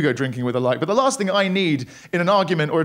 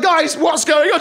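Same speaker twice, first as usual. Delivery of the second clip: higher, laughing alarmed plea; startled intervening; 11 semitones higher, 4 dB louder, slower than usual. Retold or imitated. Son stepping between arguing parents, startled and laughing. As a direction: step between them, half laughing. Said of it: "I was like".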